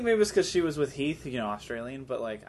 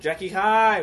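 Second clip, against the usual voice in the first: higher, louder fan cheer; 4 semitones higher, 5 dB louder, much slower than usual. Acted. Fan greets Jackie Chan on stage, excited cheer. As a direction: hail the star like an excited fan.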